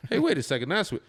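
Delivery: high-pitched puzzled double take; mock dawning suspicion. Movie viewer catching on that something is off, mock alarmed. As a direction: voice jumps way up as the doubt grows.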